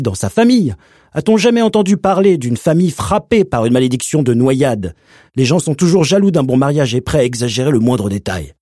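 high, loud scolding uncle. Acted. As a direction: berate the mother sharply. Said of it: "admonester sa mère".

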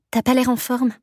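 a hint of a worried friend; lightly voiced roommate.

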